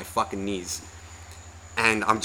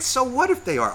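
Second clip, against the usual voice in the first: much higher mock retort; imitated exasperation; 13 semitones higher than usual. Partner dismissing his worry about more people, mock exasperated. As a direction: mimic a fed-up partner pushing back.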